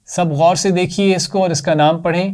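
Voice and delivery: normal talking voice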